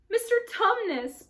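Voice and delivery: high, loud call of his name; bawling. A narrator's reading. Lucy bawling in the crying fawn's ear. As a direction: bawl it right in his ear. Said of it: "bawled Lucy in his ear".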